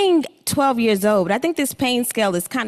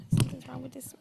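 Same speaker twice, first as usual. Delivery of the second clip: a quick breathy whisper off-mic; whispered apology; 6 semitones higher, 8 dB quieter than usual. Real, not acted. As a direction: whisper it aside while fixing the mic. Microphone trouble, apologizing aside.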